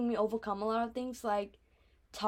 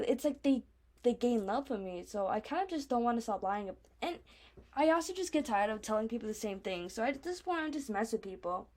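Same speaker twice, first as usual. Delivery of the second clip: usual speaking voice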